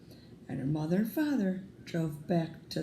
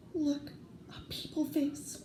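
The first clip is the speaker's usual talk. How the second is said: higher, softer and slow in wonder; tender awe; 9 semitones higher, 6 dB quieter, slower than usual. Acted. Mother finding her own baby's face, tender hushed wonder. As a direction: marvel softly at the baby's face.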